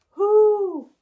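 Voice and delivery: loud high hooting owl. Acted. Teacher hoots like the owl for the kids. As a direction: hoot it out like an owl. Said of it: "The owl is saying".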